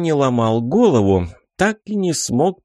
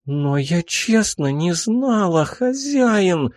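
put-on high, begging girl's plea; pleading; 7 semitones higher, slower than usual. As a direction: beg your master to believe you. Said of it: "буквально взмолилась она".

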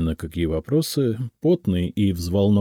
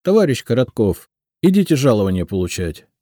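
raised, curt and angry summons; spiteful; 3 semitones higher, 4 dB louder, faster than usual. A narrator's reading. Tearful woman at the clerk's door, spiteful. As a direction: throw the order out crossly, speeding up. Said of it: "злобно сказала".

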